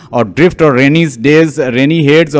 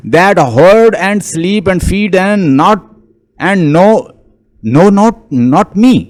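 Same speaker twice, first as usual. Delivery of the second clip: slow, raised-pitch verse reading; poem reading; 5 semitones higher, 3 dB louder, much slower than usual. Acted. Lecturer quoting restless Ulysses to students, halting poem reading. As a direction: recite it haltingly, with pauses.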